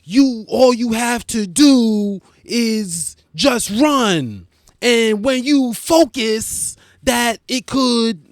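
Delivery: hammed-up mock Will Smith pep talk; Will Smith motivational. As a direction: preach it like a motivational video. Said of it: "I sound like Will Smith in a motivational".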